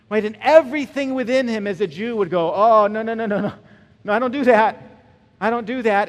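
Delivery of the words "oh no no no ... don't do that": loud protest. Acted. Peter refusing, acted protest.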